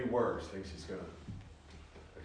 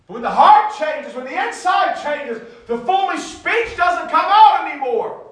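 preaching at full force; 20 dB louder than usual. Real, not acted.

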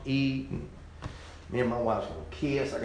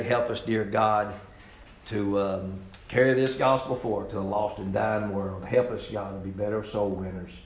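lower, subdued prayer voice; closing prayer; 5 semitones lower, 4 dB quieter than usual. Real, not acted. Preacher asking God to help the congregation, subdued prayer. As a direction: pray earnestly and quietly to close.

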